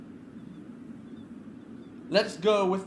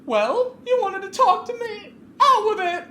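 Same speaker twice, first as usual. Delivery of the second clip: much higher, louder impatient challenge; Clarissa demanding; 8 dB louder than usual. Acted. Clarissa confronts Veronica on the upper deck, impatient and loud. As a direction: dare her to speak.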